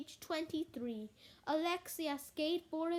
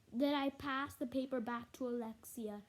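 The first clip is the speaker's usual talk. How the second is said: plain reading voice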